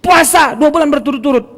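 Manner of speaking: high, loud pronouncement; acted stern ruling. Prophet orders two months of fasting, acted loud command. Ruling repeated after aside.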